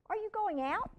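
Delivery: put-on high little-boy question; young Elliot. A narrator's reading. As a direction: ask it like a small boy. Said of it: "Elliot asked the teenager".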